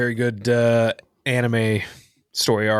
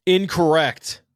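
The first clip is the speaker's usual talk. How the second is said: louder, higher ruling; host buzzer verdict; 7 semitones higher, 4 dB louder, slower than usual. Pretend. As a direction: announce it wrong like a quiz host. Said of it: "quiz host verdict".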